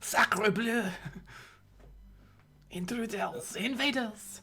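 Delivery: hammed-up French accent, loud; french chef. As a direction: shout like a panicked French chef. Player voices French creature.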